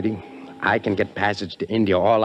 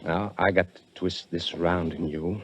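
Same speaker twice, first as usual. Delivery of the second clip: slow quiet menace; 3 semitones lower, 7 dB quieter than usual. Acted. Threatens Korchak with knife.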